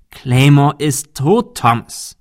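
"Claymore ist tot" slow, loud and firm; emphatic. A narrator's reading. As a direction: stress "Claymore ist tot" deliberately, then drop on "Thomas". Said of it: "betonte Arthur". („Claymore ist tot“ langsam und nachdrücklich betont)

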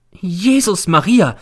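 high, loud, drawn-out cry of horror; aghast housekeeper's exclamation; horrified cry. Acted. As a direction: cry out in horror, stretching the words. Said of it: "schreit die Hausfrau und wird sehr entsetzt".

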